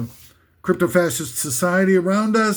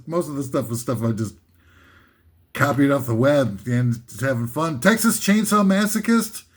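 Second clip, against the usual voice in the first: normal talking voice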